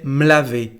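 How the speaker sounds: "me laver" said m'lavé, no E; fuse m onto "laver"; contracted me. Shown as a demonstration. Teacher showing the contraction, "me laver" said as m'lavé. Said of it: "without the letter E".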